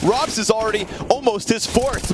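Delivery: high hyped play-by-play; esports caster. Real, not acted. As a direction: call the multi-kill. Live play-by-play.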